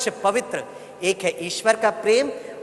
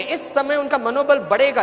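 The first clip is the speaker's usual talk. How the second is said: ordinary talk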